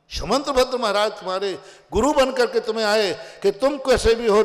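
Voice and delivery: usual speaking voice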